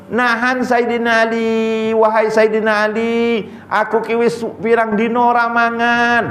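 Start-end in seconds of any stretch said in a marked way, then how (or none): 1.9-6.3 a higher pleading beggar in Javanese. Retold or imitated. Beggar begs Saidina Ali for food, a higher Javanese plea. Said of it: "Tapi tak cakap jauh lah".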